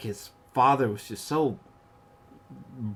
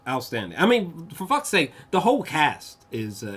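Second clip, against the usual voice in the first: usual speaking voice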